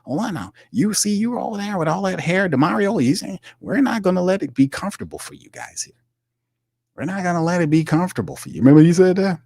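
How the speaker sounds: high, hammed-up berating voice; mock berating GM. Host imitating the GM berating players, high mock voice. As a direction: lecture the players like a pompous boss. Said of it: "he was in there berating them".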